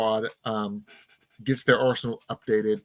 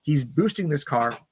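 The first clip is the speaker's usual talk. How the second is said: normal talking voice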